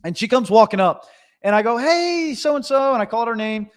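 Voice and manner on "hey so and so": high, drawn-out friendly hail; calling out a greeting. Retold or imitated. Contractor hails an arriving client, calling out. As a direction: call over to her, pitched up.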